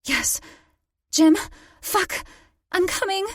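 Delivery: building to a climax cry. A narrator's reading. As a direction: ecstatic mounting cries. Climax in love scene.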